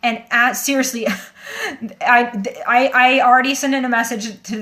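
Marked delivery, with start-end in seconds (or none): 1.4-2.0 quick high breathy giggle; a brief giggle that breaks through; short high laugh. Real, not acted. Telling viewers about the hack, short high giggle. Embarrassed laugh mid-story.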